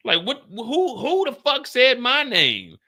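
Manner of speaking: high, loud, slow who said my name; outraged. Real, not acted. Wondering who named him, a loud outraged demand. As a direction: demand it in disbelief.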